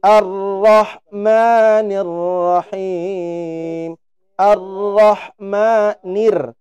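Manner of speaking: "Ar-Rahmanir" chanted very slowly with a clear nir; slow, chanted recitation; Quran recitation. Shown as a demonstration. Teacher chants "Ar-Rahmanir-Rahim" as the model. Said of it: "tapi nir".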